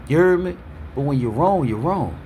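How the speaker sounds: ordinary talk, nothing marked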